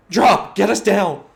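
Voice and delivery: high, loud panicked yell. Acted. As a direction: scream the order from midair. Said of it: "She yelled".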